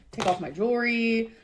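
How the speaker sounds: ordinary delivery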